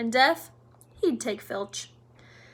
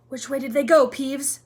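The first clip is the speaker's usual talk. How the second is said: demanding questioning; 3 semitones higher, 8 dB louder than usual. A narrator's reading. Filch urgently questioning Peeves.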